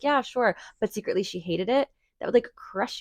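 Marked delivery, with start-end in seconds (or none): 0.0-0.6 fake agreement. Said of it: "your friend was like".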